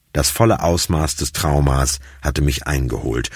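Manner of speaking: usual speaking voice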